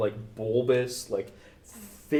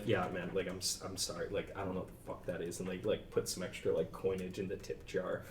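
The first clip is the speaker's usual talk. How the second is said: soft, embarrassed mumble, much quieter; sheepish apology; 5 semitones lower, 11 dB quieter, faster than usual. Retold or imitated. Students apologising to the barista, quietly sheepish. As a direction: apologise under your breath. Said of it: "students start to move up and be like".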